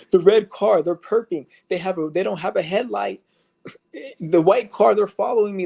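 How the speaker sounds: hammed-up paranoid rant, pitched well up; paranoid street guy. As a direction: play a panicked man sure he's being followed. Imitating targeted individuals.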